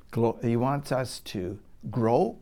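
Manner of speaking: usual speaking voice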